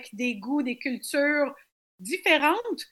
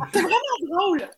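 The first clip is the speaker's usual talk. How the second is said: said through a real laugh, pitched up; 4 semitones higher, much faster than usual. Real, not acted. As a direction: laugh it out, amused. Laughing at question about annexation.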